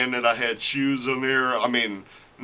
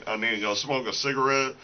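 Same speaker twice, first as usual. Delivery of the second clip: usual speaking voice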